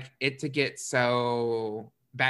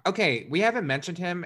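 normal talking voice

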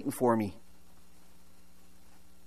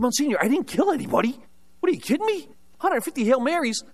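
much higher, disbelieving outcry; incredulous protest; 9 semitones higher than usual. Retold or imitated. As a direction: leap up in pitch at the absurd penance. Retold protest at huge penance.

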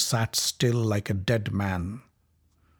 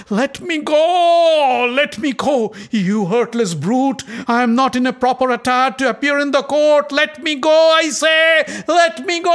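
much higher, loud desperate screams; screaming in pain; 13 semitones higher, 7 dB louder than usual. Acted. Draupadi screaming at her captor, high and desperate. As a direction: scream to be let go. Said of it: "Draupadi screamed in pain".

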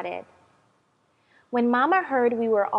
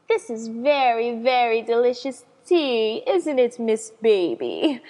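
proper lady voice; 5 dB louder than usual. Acted. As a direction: play the refined hostess. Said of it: "I said properly".

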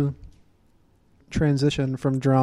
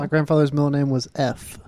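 usual speaking voice

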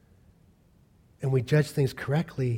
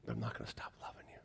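fast tender whisper; 3 semitones lower, 17 dB quieter, faster than usual. Acted. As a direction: breathe it out quickly and softly. Tender pledge after the accusation.

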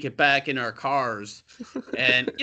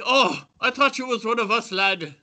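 higher-pitched, hammed-up Irish bloke's accent; 8 semitones higher than usual. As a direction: imitate the friendly Irish guy at the urinal, in an Irish accent. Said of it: "this guy next to me, he's like".

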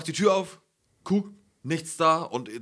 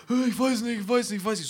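high-pitched imitation of tearful babbling; mock wailing; 7 semitones higher than usual. Boyfriend mimicking his girlfriend's confused breakdown, mock wailing. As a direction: whine it out like someone breaking down, exaggerated. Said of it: "fängt sie an zu schreien und an zu heulen".

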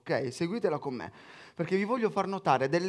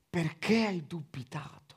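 hushed whispered question; Jesus gently rebuking Peter, whispered. Acted.

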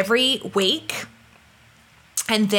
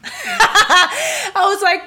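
laughing; 12 semitones higher, 6 dB louder, much slower than usual. Real, not acted. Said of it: "I start crying".

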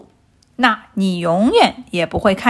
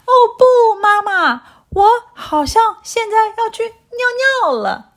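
high, embarrassed little voice; flustered child caught short; embarrassed puppy; 11 semitones higher than usual. A narrator's reading. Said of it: "汪汪很尴尬地说".

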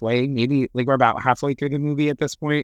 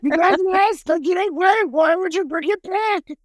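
much higher, squeaky mock scolding; mocking squeak. Mocking the guards for bringing the book back, squeaky scold. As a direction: squeak it at them like they're idiots.

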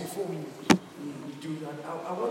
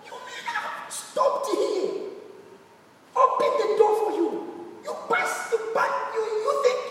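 angry; 11 semitones higher than usual. Retold or imitated. Angry man retold in sermon.

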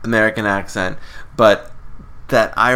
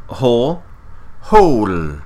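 put-on Irish accent. Imitating Maureen O'Hara's Dublin "Hole" to a co-host. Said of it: "She would say".

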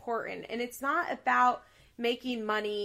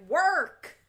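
mock stern mom; 7 semitones higher, 7 dB louder than usual. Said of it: "her mom is like".

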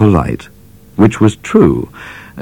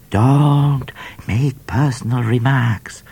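slow, higher, mock snapping; a lightly voiced cat snapping back; snapped; 7 semitones higher, slower than usual. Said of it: "snapped Cat".

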